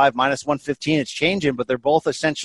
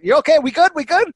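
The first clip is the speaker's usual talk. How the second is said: high, louder anxious questions; worried check-in; 10 semitones higher, 4 dB louder, faster than usual. Real, not acted. Host checking on his choking co-host, high and worried. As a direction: check he's breathing, urgently.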